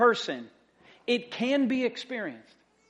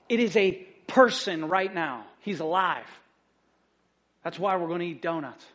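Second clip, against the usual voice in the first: ordinary talk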